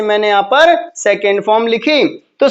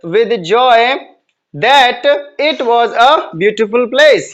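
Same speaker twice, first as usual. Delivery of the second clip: very slow, word by word, the whole answer sentence; slow dictation; much slower than usual. Shown as a demonstration. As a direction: dictate it slowly for the class to write. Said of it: "इसका सही narration बनेगा". (पूरा वाक्य बहुत धीरे-धीरे, शब्द-दर-शब्द बोलते हुए)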